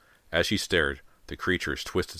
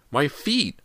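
high, loud pained cry; 11 semitones higher, 5 dB louder than usual. A narrator's reading. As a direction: yelp as the feet burn. Feet burning in tar.

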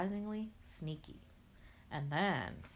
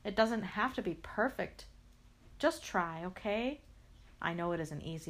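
ordinary delivery

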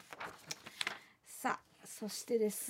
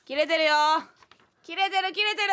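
bodybuilder cheer; 5 semitones higher, 6 dB louder than usual. Pretend.

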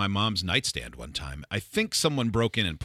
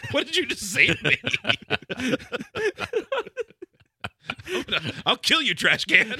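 laughing mock outrage; 12 semitones higher than usual.

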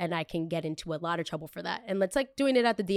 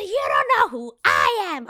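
much higher, louder mocking repeat; mocking echo; 11 semitones higher, 8 dB louder than usual. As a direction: throw her words back sarcastically. Mocking the clerk's words back.

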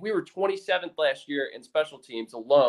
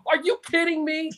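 exasperated wife; 10 semitones higher than usual. Retold or imitated. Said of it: "She was like".

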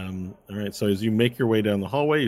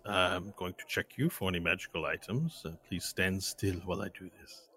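softer, measured official voice; robed inspector; 8 dB quieter than usual. Acted. Robed man checks Varel for magical items, quietly. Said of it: "he steps to you and he says".

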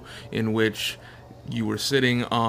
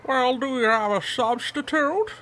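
put-on frail old man; 11 semitones higher than usual. GM voices the old Nurgling.

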